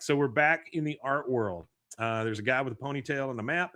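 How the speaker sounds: ordinary talk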